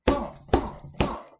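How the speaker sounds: high, loud thumping close by; the giant is right at the door, thump hard. Acted. Storyteller sounds the monster arriving at the house, loud thumps.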